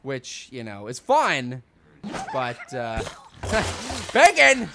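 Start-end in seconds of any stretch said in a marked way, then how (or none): 4.1-4.7 loud, high single yell; high shout. Real, not acted. Shouting bacon.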